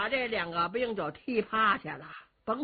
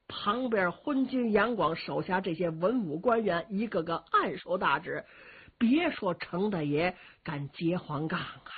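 plain reading voice

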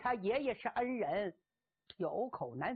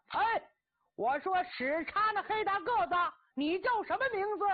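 bold yell; 8 semitones higher, 4 dB louder than usual. Acted. Cheng Yaojin yells at captor.

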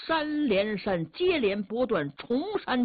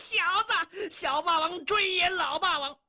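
high, amused mocking call; fleeing warrior chuckling back at his chaser; laughing taunt; 8 semitones higher than usual. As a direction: laugh at the pursuer as you tease. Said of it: "姚琦这乐言".

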